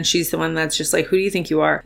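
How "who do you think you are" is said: a hint of a sneering inner critic, softer; quiet mock imposter voice; Rachel the imposter. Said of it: "Rachel's my imposter version".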